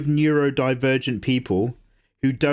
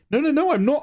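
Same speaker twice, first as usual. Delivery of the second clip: defensive protest; 12 semitones higher, faster than usual. Real, not acted.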